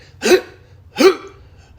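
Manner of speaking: high, loud wheezing gasp; imitated dog gasp. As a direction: mimic a choking gasp. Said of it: "she gets this weird thing".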